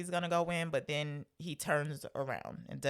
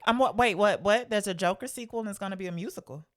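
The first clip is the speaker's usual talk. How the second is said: louder, higher, stumbling disbelief; retold surprise; 4 semitones higher, 7 dB louder than usual. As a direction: blurt out a startled double take, quickening. Retold shocked reaction to news.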